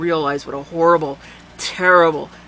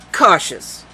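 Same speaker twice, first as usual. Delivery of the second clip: plain reading voice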